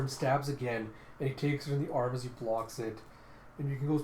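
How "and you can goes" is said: ordinary delivery, nothing marked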